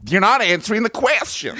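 Lindsey Graham. Acted. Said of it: "This is supposed to be Lindsey Graham".

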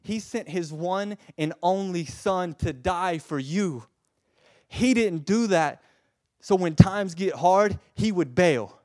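loud, high impassioned preaching; full-force preaching. Acted. Preacher to congregation, loud impassioned preaching. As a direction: plead with fire.

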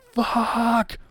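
high-pitched drawn-out mock curse; hammed-up livid outburst, imitating a boss. Brody Lee storms in on Uno, livid. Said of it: "he's livid".

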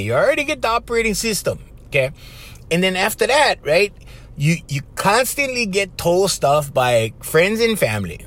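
ordinary talk

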